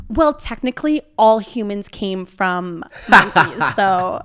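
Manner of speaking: put-on slow, mildly smug kid voice; know-it-all kid self. Retelling her seventh grade reply to her sister. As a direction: lightly play your know-it-all younger self. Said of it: "typical seventh grade me was like".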